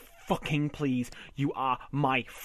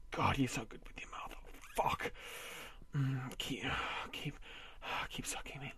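breathy whisper, much softer; 9 dB quieter than usual. Acted. Husband whispering in pleasure to his wife. Intimate moment, unvoiced.

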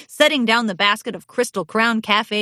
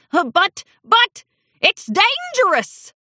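high, stammering panic; panicked old man; 12 semitones higher, 4 dB louder than usual. A narrator's reading.

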